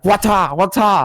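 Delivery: high-pitched kung-fu style calls; mock fight cries. Friends acting like they have knives, mock fight cries. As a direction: yelp like a pretend fighter.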